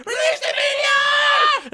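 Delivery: high hammed-up screaming order; mock scream. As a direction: scream it dramatically. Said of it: "Doctor Evil bit".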